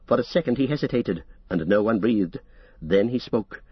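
usual speaking voice

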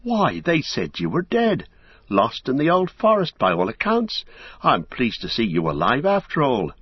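put-on a startled old gatekeeper hobbit. Old hobbit greeting Merry in surprise, high and fussy. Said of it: "said old Hob".

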